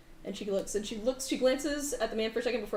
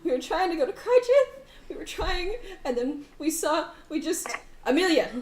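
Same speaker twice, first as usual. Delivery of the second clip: high, breaking sob-talk; crying woman; 7 semitones higher, 5 dB louder than usual. Acted. GM as the weeping mother explaining where they were going, high and tearful. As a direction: choke out the story through tears. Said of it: "she starts crying profusely".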